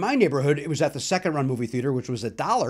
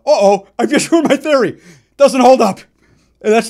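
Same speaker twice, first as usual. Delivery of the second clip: real laugh mid-sentence, higher and louder; laughing at himself; 6 semitones higher, 11 dB louder than usual. Real, not acted. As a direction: crack up as the theory falls apart. Catches himself contradicting.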